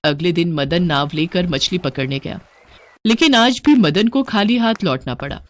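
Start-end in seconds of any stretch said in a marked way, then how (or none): none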